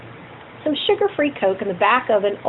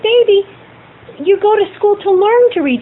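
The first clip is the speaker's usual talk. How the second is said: put-on higher, louder comforting to a child; reassuring mother; 8 semitones higher, 5 dB louder than usual. Mother comforting her crying daughter about school. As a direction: reassure the crying little girl. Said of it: "I said".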